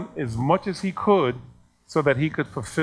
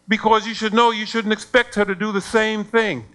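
retold angry scolding; 7 semitones higher, 4 dB louder than usual. Acted scolding by angry parent.

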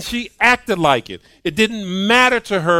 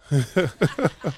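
laugh; 4 dB quieter than usual. Real, not acted. Laughing at parent's admission.

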